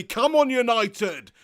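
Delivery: loud, slow cheer for the team; rallying cheer. Real, not acted. Streamer cheering United as the players walk out, loud and slow. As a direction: shout the team on as they come out.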